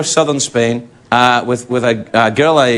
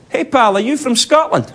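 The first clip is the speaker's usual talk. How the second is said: higher-pitched imitated Scottish accent; 9 semitones higher than usual. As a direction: greet a stranger in a put-on Scottish accent. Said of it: "exactly in this accent I'm about to do".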